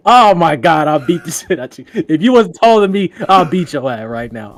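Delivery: retold roast, surging up and falling back; friend losing it. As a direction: imitate a friend exploding in mock outrage.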